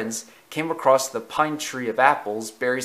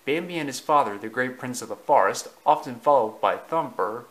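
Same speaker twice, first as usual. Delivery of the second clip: usual speaking voice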